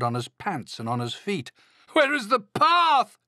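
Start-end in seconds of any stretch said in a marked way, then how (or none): 1.9-3.2 very high, loud drawn-out wail; lost and panicking storybook Toad; distressed cry. A narrator's reading. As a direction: wail it out, stretching the words. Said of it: "cried Toad".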